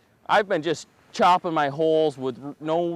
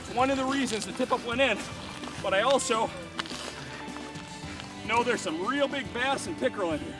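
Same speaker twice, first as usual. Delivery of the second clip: excited; 7 semitones higher, 6 dB quieter than usual. Real, not acted. Pulling up a big fish.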